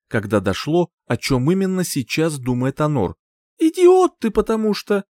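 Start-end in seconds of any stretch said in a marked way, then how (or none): 3.6-5.0 high, loud exasperated outburst. A narrator's reading. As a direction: burst out high at the fool. Heroine insults prince in frustration.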